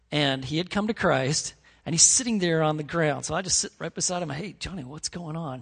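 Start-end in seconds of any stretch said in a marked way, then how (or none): 4.3-5.6 gentle concern. Retold or imitated. Youth pastor sitting down beside crying Johnny, softly concerned. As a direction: retell a careful, hushed approach.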